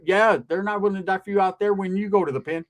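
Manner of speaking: normal talking voice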